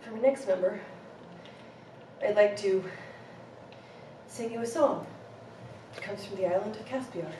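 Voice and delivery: soft, timid foreign storyteller; Andy Kaufman character voice. Pretend. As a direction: speak softly like a shy foreigner. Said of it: "an homage to Andy Kaufman".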